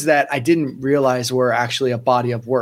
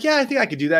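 eager yes; 10 semitones higher, much faster than usual. Retold or imitated. Eager reply to job offer.

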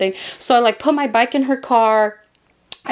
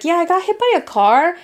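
breezy, slightly higher-pitched retelling; casual; 5 semitones higher than usual. Retold or imitated. Child telling mom about the accident, offhandedly casual. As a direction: toss it off like nothing happened. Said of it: "I'm over here casually like".

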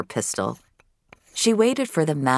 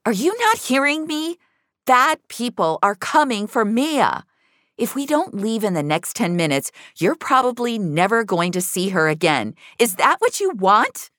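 urgent plea; 10 semitones higher than usual. A narrator's reading. Finch urging the father to flee, high and insistent. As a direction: push him hard to make him listen.

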